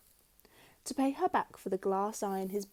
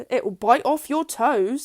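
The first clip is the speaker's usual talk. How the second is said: slow, louder, spooky; menacing husband; 4 semitones higher, 9 dB louder than usual. A narrator's reading. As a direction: drag it out to frighten her. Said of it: "said Mister Twit".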